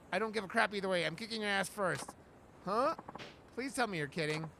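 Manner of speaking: ordinary talk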